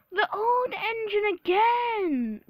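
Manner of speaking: high, slow, loud moan; hammed-up grumble; James grumbling. A narrator's reading. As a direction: complain in a drawn-out whine. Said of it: "grumbled James".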